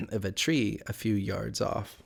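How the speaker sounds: ordinary delivery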